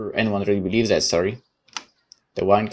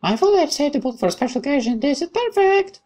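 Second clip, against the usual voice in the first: put-on much higher, bright girlish voice; cheerful young woman; 5 dB louder than usual. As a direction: play the friendly girl who sneaks up, chirpy.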